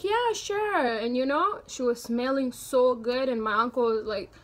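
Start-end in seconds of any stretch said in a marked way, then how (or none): 0.0-0.8 put-on high-pitched girl's reply; lightly acted, high agreeable answer; girl accepting a lift.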